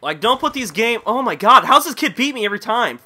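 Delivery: high, loud frustrated complaint; exasperated. Real, not acted. Gamer beaten by the same kid, exasperated.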